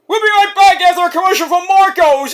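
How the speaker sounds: high, loud hammed-up broadcaster; mock announcer. Fan mimicking the TV announcer, hammed-up. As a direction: parody the commercial break call.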